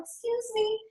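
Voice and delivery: high, soft little interjection; polite approach. Retold or imitated. Coach retelling meeting someone at the store, soft polite approach. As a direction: softly get a stranger's attention.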